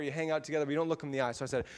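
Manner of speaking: ordinary talk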